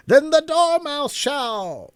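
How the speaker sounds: both crying Then the Dormouse shall; cried out. A narrator's reading. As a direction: cry it out in triumph. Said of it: "they both cried".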